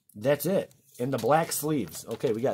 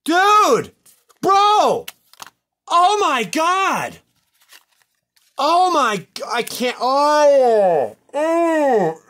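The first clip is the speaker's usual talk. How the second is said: high, loud wails of disbelief; shocked yelling; 13 semitones higher, 10 dB louder, much slower than usual. Real, not acted. Host overwhelmed by the ruined card, wailing shock. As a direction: yell in short bursts, each falling off.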